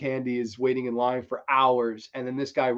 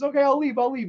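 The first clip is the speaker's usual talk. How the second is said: high, raised-voice mock surrender; flustered retreat; 12 semitones higher, 4 dB louder than usual. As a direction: give in hastily, acting it out. Flustered man agreeing to go.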